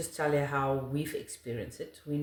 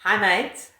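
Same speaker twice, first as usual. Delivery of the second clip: a louder, cheery Aussie "Hi, mate."; casual Australian hello, a touch hammed-up; Aussie greeting; 5 semitones higher, 8 dB louder than usual. Shown as a demonstration. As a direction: toss out "Hi, mate." like a local Aussie. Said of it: "you say".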